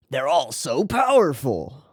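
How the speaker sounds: ordinary talk, nothing marked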